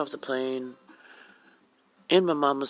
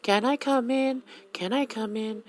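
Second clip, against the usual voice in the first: imitating a kid yelling; 7 semitones higher than usual. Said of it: "he always yells".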